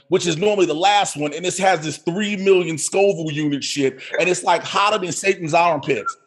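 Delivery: ordinary delivery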